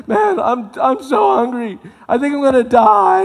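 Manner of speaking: high, loud, hammed-up whine that he's about to die; starving Esau. Esau back from hunting begging his brother, in a loud, high hammed-up whine. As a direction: play the famished brother, over the top. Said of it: "he's like".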